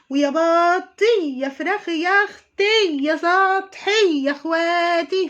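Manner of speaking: put-on high, loud, slow whiny old-lady voice; spoiled old woman. Mocking the YouTuber as a coy old woman, high loud whine. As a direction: ham up an old crone acting coy. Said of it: "عارفين العجوزة بقى لما تتدلع؟".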